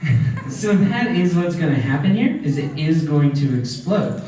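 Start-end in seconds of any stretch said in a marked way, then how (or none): none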